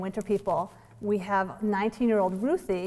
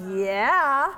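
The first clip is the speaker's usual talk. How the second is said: lightly voiced eager child; 5 semitones higher, 5 dB louder than usual. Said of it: "And Stella said".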